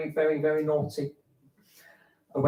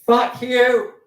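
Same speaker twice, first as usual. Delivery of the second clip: mock shout; 9 semitones higher, 10 dB louder than usual. Repeated louder retort.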